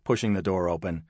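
plain reading voice

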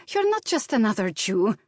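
put-on high Slavic-accented insistence; urgent Slavic accent. A narrator's reading. Maid warning her employer, urgent Slavic accent. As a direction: impress it on him in a Slavic accent. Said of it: "her accent growing stronger".

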